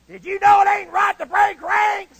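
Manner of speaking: loud, high drill instructor bellow; Flanagan. Retold or imitated. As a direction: bawl out the recruit. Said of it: "he talked just like this".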